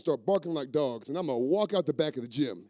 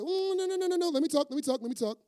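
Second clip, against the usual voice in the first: high, hurried interrupting voice; cutting him off. Acted. Coach hushing his player, lightly high and dismissive. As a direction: talk over him, waving him off.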